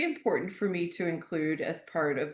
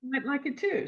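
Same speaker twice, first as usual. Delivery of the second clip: high-pitched, pleased imitation of an algorithm; delighted algorithm; 9 semitones higher than usual. Amazon algorithm noticing that people like a book, delighted. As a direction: play an algorithm perking up at a discovery. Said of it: "it cues the wonderful algorithm at Amazon".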